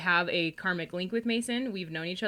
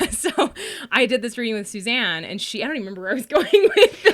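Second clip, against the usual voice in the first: laughing, louder and fast; 4 semitones higher, 10 dB louder than usual. Real, not acted. Losing her thread, laughing louder. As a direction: giggle through it.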